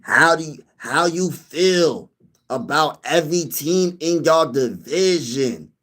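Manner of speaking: slow, drawn-out mocking voice of the commenter; imitating a viewer. Mocking his homie's comment to the viewers, slow and drawn out. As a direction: stretch every word like a dopey friend reading his own question. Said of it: "imitate with how he said it".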